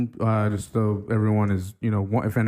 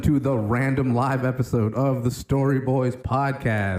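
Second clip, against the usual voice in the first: announcer intro. Acted. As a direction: welcome listeners like a host.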